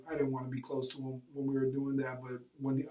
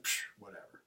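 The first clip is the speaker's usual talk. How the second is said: dismissive scoff; 8 semitones lower, 11 dB quieter than usual. Retold or imitated. Teen brushes off the coach.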